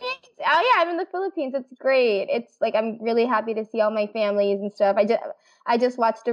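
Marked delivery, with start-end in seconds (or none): 0.0-1.5 surprised. Real, not acted. Surprised at sudden question.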